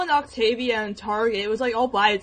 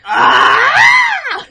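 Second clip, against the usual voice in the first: much higher, louder squeaky yelp; voice-crack yelp; 14 dB louder than usual. Shown as a demonstration. Girl demonstrating Wander's cracking voice yelp. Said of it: "with the emphasis of his cracking his voice".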